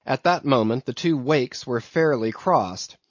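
plain reading voice

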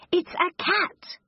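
high-pitched storybook cry; shouted alarm. A narrator's reading. Jack warning Mac about the cat, high alarmed cry. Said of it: "shouts Jack".

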